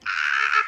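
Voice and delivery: short loud "Ah!"; mock fan scream from the audience; demonstration scream. Shown as a demonstration. Showing a performer how they'll cheer, screaming. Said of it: "two people going like".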